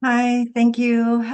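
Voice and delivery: ordinary talk, nothing marked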